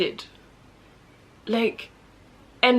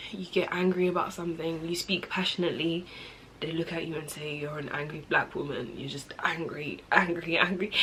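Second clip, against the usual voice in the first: subdued; 6 semitones lower, 10 dB quieter than usual. Real, not acted. Bitterly retells being labelled angry.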